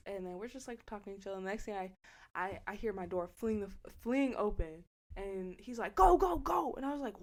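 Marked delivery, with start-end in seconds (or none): 5.9-6.7 high, loud frantic go go go; panicked urging. Retold or imitated. Friend jumping in the car yelling at the driver, frantic shout. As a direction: shout it like you just robbed someone.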